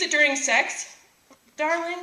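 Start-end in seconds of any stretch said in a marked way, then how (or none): 1.6-2.0 higher-pitched mock bedroom request; mock seductive lover. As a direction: coax a darling, hammed-up. Mock request to a lover during sex.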